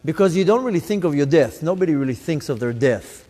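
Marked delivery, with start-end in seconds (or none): none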